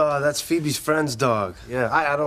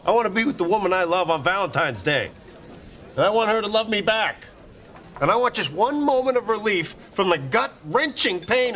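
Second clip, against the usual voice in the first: pitched-up lovesick rant; anguished outburst; 6 semitones higher than usual. Acted. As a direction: pour out the heartache, building higher. Lovesick outburst over a scone.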